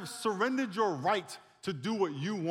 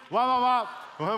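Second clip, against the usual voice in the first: very loud, high group-cheer wahs; chanted "wah" noise; 7 semitones higher, 8 dB louder, slower than usual. Shown as a demonstration. Preacher leading the congregation on three, shouting. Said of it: "let's do it on three".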